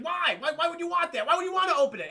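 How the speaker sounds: high, loud incredulous questioning; hammed-up arguing, pitch pushed right up; mock alarmed protest. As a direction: protest like it's madness. Acted argument in film scene.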